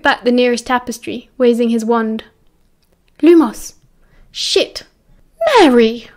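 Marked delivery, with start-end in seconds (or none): 3.2-6.1 startled gasp at a discovery. A narrator's reading. Lily finding her friend behind the tapestry, gasped in shock. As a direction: blurt it out, caught by surprise. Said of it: "Lily gasped".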